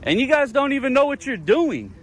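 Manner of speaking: high-pitched, heated complaint; indignant. Real, not acted. Detained man complains of twenty-minute detention, indignant. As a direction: vent with the pitch held high.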